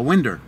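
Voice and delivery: "winder" ending in an -er sound; friend's Tennessee pronunciation. Retold or imitated. Quoting a Tennessee buddy asking for help, "winder" with final -er. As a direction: say "winder" with an r on the end. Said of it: "he calls it a winder".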